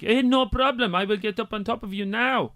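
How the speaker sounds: hammed-up Polish girlfriend voice; mock Polish accent. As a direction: put on an obliging Polish woman's accent. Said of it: "She's from Poland".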